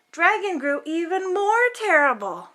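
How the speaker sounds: ordinary delivery, nothing marked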